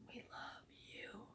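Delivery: breathy whisper, pitched a bit up. Real, not acted. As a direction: whisper it close. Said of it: "whispered then said aloud".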